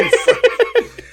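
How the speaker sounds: laughing. Real, not acted.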